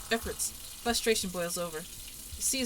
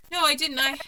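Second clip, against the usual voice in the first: much higher and louder, cut off; panicked denial; 7 semitones higher, 9 dB louder than usual. Acted. Denying the accusation to the ghost, panicked and high.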